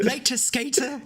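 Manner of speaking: high, drawn-out mock Dickensian accent; exaggerated Oliver Twist British accent, slow and high. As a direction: stretch it out as a squeaky British street urchin. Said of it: "Like, that accent thing".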